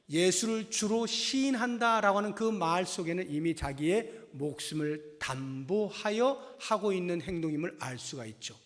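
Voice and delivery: normal talking voice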